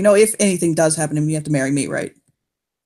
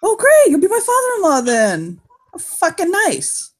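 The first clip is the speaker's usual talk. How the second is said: mock delight; 9 semitones higher than usual. Sarcastic reply to Dev.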